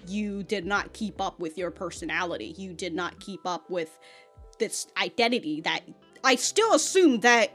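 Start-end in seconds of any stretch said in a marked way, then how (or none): none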